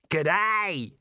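high, hearty called-out greeting; cheerful shout. A narrator's reading. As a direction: hail them gleefully from alongside. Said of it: "he shouted cheerfully".

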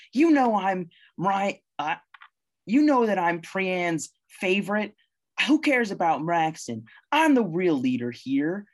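high, loud indignant outburst; a monkey, insulted, shouting high and loud at the table; furious monkey rant. Acted. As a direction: puff up and rage at the insult. GM voices furious monkey ranting.

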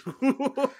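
sudden high-pitched laugh. Real, not acted. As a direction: burst out laughing. Laughs after teasing cohost.

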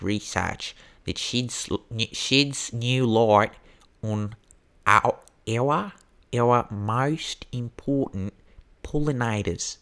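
hammed-up, drawn-out Australian accent. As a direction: put on a broad, slow Australian accent. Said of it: "That was my best Australian accent".

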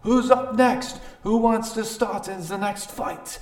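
high, loud fight announcer; ring announcer. Acted. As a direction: call for challengers across the ring. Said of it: "another guy step in the ring and says".

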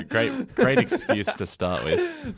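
high, slow, through laughter; mock the excuse while laughing; loud laughing jibe; laughing sarcasm. Real, not acted. Listener laughs at the weak excuse.

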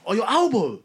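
mock surprise. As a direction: act out the surprised reaction. Retold reaction, louder and higher.

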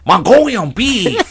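slow, drawn-out fake Mongolian accent; hammed-up Mongolian accent on the dish name; mock Mongolian accent. Diner ordering fried rice, mock Mongolian accent. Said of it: "start going into accents".

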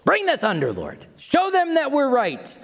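hammed-up pleading for vengeance; mock fervent plea. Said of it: "I want the Lord just to bring the thunder".

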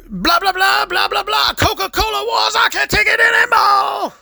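high, hammed-up yelling build to a breakdown. Mocking [name]'s song crescendo, high mock shout. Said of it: "[name]'s, uh, We Didn't Start the Fire".

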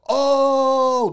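high, loud taunting oh; crowd oohing. Retold or imitated. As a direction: ooh like the crowd. Whole party reacts.

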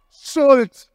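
a loud, high "Salt."; exclaimed. Real, not acted. Preacher punches out the punchline word, loud exclaim.

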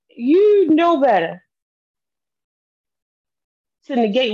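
loud, slow, high angry shout. Real, not acted. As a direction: hit it hard and slow. Furious accusation.

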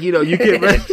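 slightly high, loud mimicry of the masseuse; quoting the masseuse. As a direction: lightly voice the masseuse giving instructions. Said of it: "But she's like".

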